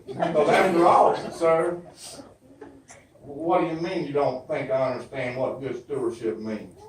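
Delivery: ordinary talk, nothing marked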